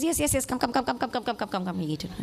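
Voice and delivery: imitating a rushed, dismissive mother; rapid repeated words sinking in pitch and volume; hurried mother. Mother rushing her hungry child to eat, hurried.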